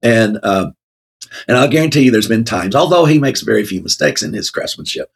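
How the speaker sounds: ordinary talk, nothing marked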